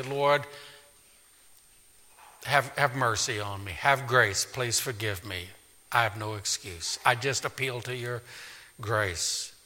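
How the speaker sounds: soft, low prayer of pleading; hushed penitent prayer to God; humble plea. Acted. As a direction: beg quietly for mercy.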